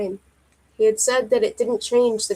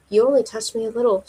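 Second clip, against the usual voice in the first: plain reading voice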